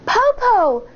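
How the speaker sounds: put-on high little girl calling; high child voice; child's voice. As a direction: call like a small child. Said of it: "Shang said".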